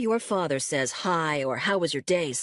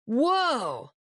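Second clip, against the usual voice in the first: drawn-out whoa; 7 semitones higher than usual. A narrator's reading. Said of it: "shouting a long, drawn-out".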